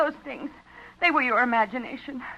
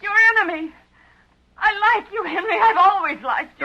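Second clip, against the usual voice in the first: high, loud anxious pleading; frightened pleading; 9 semitones higher, 7 dB louder than usual. Acted. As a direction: plead with him, scared. Pleading with her captor.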